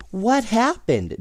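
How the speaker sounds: high, startled question; a child's startled cry; surprised cry. Acted. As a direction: cry it out in sudden alarm. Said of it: "David cried".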